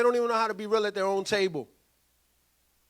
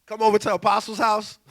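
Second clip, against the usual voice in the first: ordinary talk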